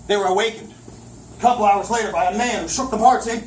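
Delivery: ordinary delivery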